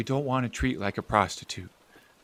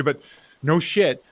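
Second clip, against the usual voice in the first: usual speaking voice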